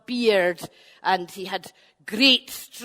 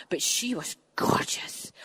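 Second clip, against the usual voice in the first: whispered aside; confiding whisper; 3 semitones lower, 7 dB quieter than usual. A narrator's reading. Storyteller sharing a secret with the children, whisper. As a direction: lean in and whisper it.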